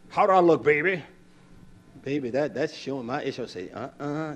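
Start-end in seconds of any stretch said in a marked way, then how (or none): 0.1-1.0 raised, eager wife voice asking; voicing his wife. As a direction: mimic the wife fishing for a compliment. Wife asks husband about outfit.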